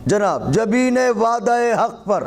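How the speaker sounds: high, declaimed verse with deliberate pauses; poetry recitation. Acted. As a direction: declaim each phrase, pitched well above chat. Repeats couplet.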